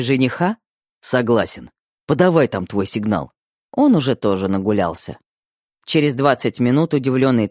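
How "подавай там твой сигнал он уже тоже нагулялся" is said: plain reading voice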